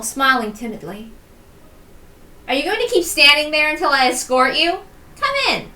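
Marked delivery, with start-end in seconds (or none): 2.5-5.7 lightly voiced sister, a touch louder and higher; a hint of playful impatience; teasing sister. Inviting her sister inside, mild teasing push.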